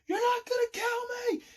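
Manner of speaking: very high, strained defiant cry; acted screaming. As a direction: scream it high without full volume. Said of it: "I'm screaming out loud".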